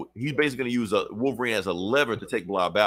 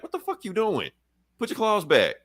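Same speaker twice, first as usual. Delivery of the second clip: much higher, exasperated scolding; imitating an angry Storm; 9 semitones higher than usual. Storm scolding Wolverine to sheathe claws, high mock anger. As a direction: snap at a teammate like an annoyed leader.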